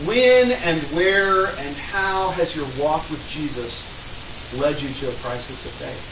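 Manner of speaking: loud, rising sermon oratory; impassioned preaching. Real, not acted. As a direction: preach it hard to the room. Preaching at full force after mic.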